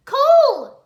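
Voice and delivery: very high, loud scream of his name; alarmed shout. Acted. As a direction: cry out in fear for him. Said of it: "Nia shouted".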